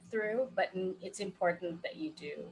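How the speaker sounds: ordinary delivery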